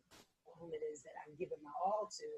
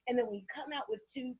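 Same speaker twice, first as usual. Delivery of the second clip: usual speaking voice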